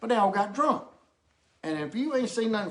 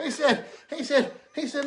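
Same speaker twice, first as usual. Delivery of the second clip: ordinary talk, nothing marked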